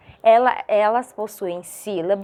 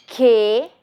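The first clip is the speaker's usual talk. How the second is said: "que" alone, loud and held; teacher's loud, deliberate "que"; isolated syllable; 6 dB louder than usual. Shown as a demonstration. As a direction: sound out "que" as one separate syllable.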